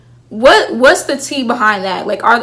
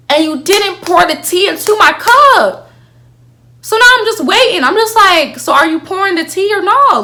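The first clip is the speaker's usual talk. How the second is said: much higher and louder, impatient and worked up; exasperated; 9 semitones higher, 5 dB louder than usual. Real, not acted. Fan to the show, high exasperated demand. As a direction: demand it like someone left waiting with an empty cup.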